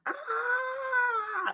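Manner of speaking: thin, high, soft sustained scream; held-back high squeal of a scream; muted mock scream. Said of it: "I was screaming like".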